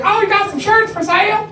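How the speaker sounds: high, flirty customer voice; playful come-on from a fan; flirty woman. Retold or imitated. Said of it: "she's like".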